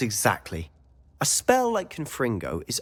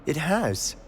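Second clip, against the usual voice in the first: ordinary talk, nothing marked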